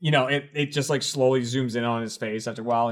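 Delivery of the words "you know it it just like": ordinary talk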